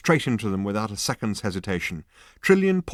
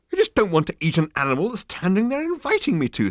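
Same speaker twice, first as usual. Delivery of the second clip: higher, louder flustered Arthur; Arthur protesting; 12 semitones higher, 7 dB louder than usual. Acted. Arthur objecting to Zaphod, higher and louder. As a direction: plead in exasperation.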